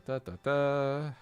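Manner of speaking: ordinary talk, nothing marked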